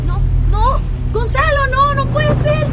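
high, loud despairing cry; anguished cry. Acted. Beatriz finds where Felipe died, anguished loud cry. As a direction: scream it out in disbelief.

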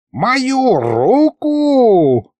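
very high, hugely drawn-out cry; outraged wail. A narrator's reading. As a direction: wail in indignation, stretching each word. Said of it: "завопил старый колдун". (возмущённый протяжный вопль)

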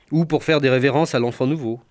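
ordinary delivery

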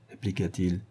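ordinary delivery

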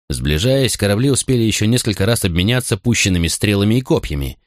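plain reading voice

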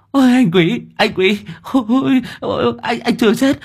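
high-pitched stammering cries; flustered alarm. A narrator's reading. Hoàng Anh finding Quý alive, high flustered cry. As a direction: gasp it out high, tripping over words. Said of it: "Con Hoàng Anh hốt hoảng".

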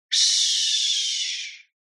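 soft unvoiced shush. A narrator's reading. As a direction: hush her quietly. Hushing her.

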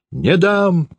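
high-pitched cry of refusal; father's shout. A narrator's reading. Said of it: "закричал отец".

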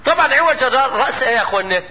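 usual speaking voice

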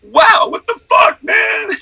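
high, loud astonished yelp; shouted wow of disbelief; amazed exclamation. Retold or imitated. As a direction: blurt it out, blown away. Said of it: "I'm just like".

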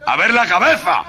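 high loud command cracking into laughs; shouted order through laughter. Acted. Sergeant yelling at the soldier, laughing through it. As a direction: laugh while barking the order.

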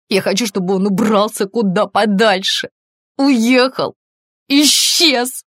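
rising agitation, word by word into a shout; distraught outburst. A narrator's reading. Distraught woman building to outburst. (всё громче и выше, на грани срыва)

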